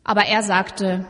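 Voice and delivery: ordinary talk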